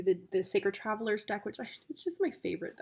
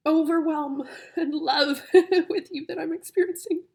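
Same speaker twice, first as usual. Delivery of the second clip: laughing through tears, higher and louder; overwhelmed, half sobbing half laughing; emotional laugh-cry; crying laughter; 7 semitones higher, 7 dB louder than usual. Real, not acted. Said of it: "Wow, I get emotional".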